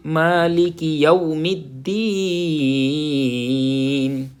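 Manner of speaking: slow, drawn-out tajweed recitation; Quranic recitation. Shown as a demonstration. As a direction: chant each word slowly. (ٹھہر ٹھہر کر تلاوت)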